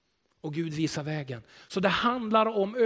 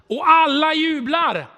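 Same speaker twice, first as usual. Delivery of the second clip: much higher and louder, slow, ringing out; jubilant shout; 11 semitones higher, 12 dB louder, slower than usual. Acted. Preacher to congregation, crying out a jubilant refrain. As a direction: cry it out like a cheering crowd.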